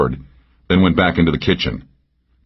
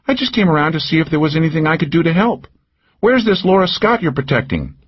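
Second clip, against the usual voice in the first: higher, teasing woman's voice; Cal's voice; 11 semitones higher than usual. A narrator's reading. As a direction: needle him with a pitched-up lilt. Narrator voices Cal.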